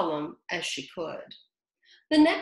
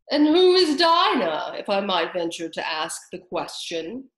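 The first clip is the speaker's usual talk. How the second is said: Lory's question; 7 dB louder than usual. A narrator's reading. Lory asking.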